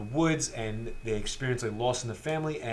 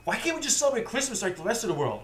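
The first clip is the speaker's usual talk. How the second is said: higher, fast whiny complaint; a sulky kid pushing back; disgruntled son; 9 semitones higher, faster than usual. Retold or imitated. The twelve-year-old complaining to his dad, higher and fast. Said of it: "son is like".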